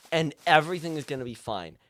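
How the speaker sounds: normal talking voice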